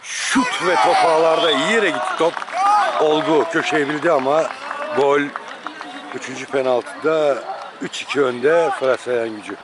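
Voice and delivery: live sports call, measured build; play-by-play commentary. Acted. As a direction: call each penalty as it happens. Live penalty shootout commentary.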